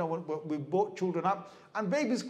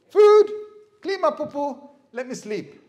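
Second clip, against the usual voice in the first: put-on baby's demands; 7 semitones higher, 11 dB louder than usual. Voicing a baby's three demands.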